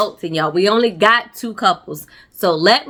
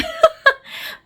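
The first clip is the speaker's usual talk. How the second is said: high, cracking laugh; sheepish high laugh. Real, not acted. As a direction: laugh at yourself. Laughs at being late.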